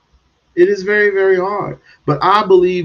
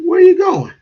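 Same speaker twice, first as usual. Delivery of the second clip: surprised man; 8 dB louder, faster than usual. Retold or imitated. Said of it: "He said".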